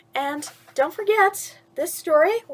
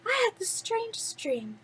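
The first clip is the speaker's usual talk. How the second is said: put-on high, soft and dreamy young girl; dreamy Cinderella; 9 semitones higher, 7 dB quieter than usual. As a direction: sigh it out like a girl still in her dream. Cinderella tells mice her dream.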